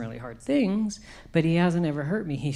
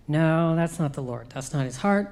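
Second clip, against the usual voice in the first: normal talking voice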